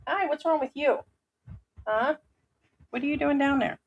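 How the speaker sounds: sweet high voice for the pussycats; pet talk to cats. Real, not acted. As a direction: coo to the cats like babies. Talking to the cats.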